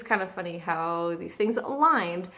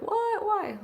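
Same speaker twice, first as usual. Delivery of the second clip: high incredulous "what, why"; surprised disbelief; 13 semitones higher than usual. Retold or imitated. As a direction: play the shocked listener, pitched up. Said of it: "I know some of you are like".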